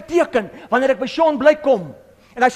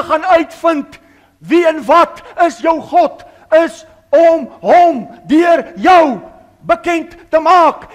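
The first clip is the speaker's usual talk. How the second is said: slow emphatic shout; 5 semitones higher, 7 dB louder, slower than usual. Real, not acted. Preacher pressing the congregation, slow emphatic shout. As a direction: drag it out, louder and higher. Preacher drives the point home.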